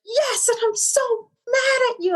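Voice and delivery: put-on high child's voice, mad but small; a son telling his mom he's mad, voiced high; imitating son mad. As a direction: voice the little boy saying he's mad. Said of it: "He's like".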